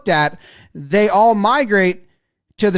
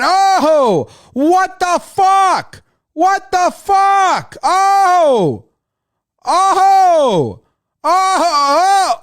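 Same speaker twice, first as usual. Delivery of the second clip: high, drawn-out outraged fan shout; exaggerated fan outrage, pitched way up; acted unhappy fan; 10 semitones higher, 4 dB louder, much slower than usual. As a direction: wail in disbelief from the stands. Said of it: "This fan wasn't too happy about it".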